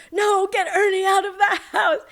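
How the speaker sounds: high, loud re-enacted scream; retold scream. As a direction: cry out in mock panic, pitched high. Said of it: "We were screaming".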